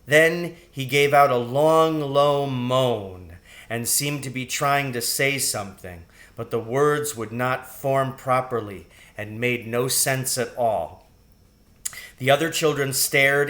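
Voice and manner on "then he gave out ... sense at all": usual speaking voice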